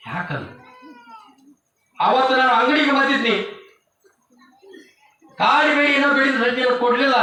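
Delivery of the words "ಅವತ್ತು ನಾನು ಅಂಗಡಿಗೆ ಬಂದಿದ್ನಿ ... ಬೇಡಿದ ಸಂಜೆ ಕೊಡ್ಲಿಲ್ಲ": much higher, louder accusing cry; anguished shout. Retold or imitated. Condemned son cries out to his mother, much higher and louder. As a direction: cry out the question in despair.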